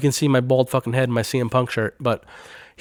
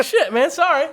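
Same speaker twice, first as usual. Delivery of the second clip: laughing apology; 12 semitones higher than usual. Retold or imitated. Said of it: "I'm like".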